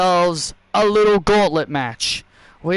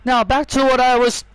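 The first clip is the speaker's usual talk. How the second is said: ordinary talk, nothing marked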